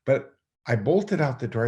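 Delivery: usual speaking voice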